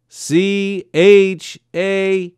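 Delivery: very slow, letter by letter; spelling out. A narrator's reading. Father spelling Chanukah for the colonel, slowly. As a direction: drag out each letter.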